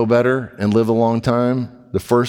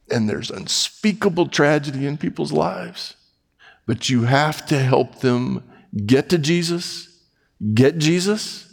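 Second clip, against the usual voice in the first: tender earnest preaching; 5 semitones higher than usual. Acted. Impassioned preaching climax.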